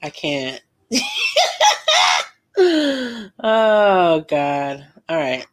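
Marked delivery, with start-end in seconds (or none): 0.9-2.2 very high shrieking laugh. Real, not acted. Vlogger shrieking with laughter at her own joke.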